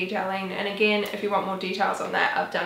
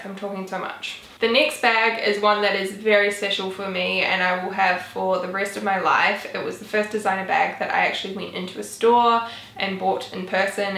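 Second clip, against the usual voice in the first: ordinary talk, nothing marked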